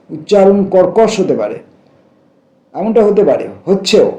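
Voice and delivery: ordinary talk, nothing marked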